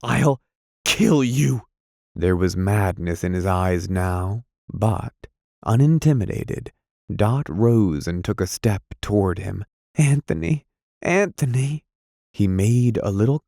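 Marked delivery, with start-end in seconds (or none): 0.0-1.6 screaming in madness; man screams threats at her; frantic threats. A narrator's reading. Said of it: "he cried". 9.9-11.8 high pleading call of his name; woman calls his name. A narrator's reading. She calls to him.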